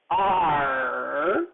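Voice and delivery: long, low pirate "arr". Shown as a demonstration. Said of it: "I say it like a pirate".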